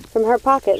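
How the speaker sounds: ordinary delivery, nothing marked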